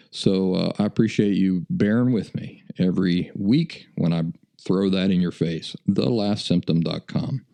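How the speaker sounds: ordinary talk, nothing marked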